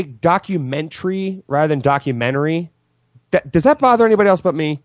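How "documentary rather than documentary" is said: "documentary" ending in -tree, then in -ary with the t dropped; two pronunciations. Shown as a demonstration. Host complaining about how people talk, two pronunciations of "documentary". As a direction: contrast "documentary" as docu-men-tree and docu-men-ary. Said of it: "the people who say".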